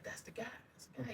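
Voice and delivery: very soft, furtive mock chatter; imitated whispering. Guest mimics mall strangers spotting him, hushed whispering. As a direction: murmur it like onlookers spotting someone. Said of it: "these little whispering chat".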